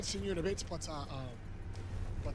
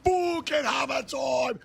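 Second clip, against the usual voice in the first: hype shout; 9 semitones higher, slower than usual. Real, not acted. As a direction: yell it out to the chat, pumped up. Streamer hyping viewers by name.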